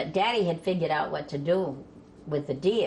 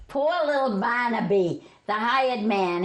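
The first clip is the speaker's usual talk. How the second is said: ordinary delivery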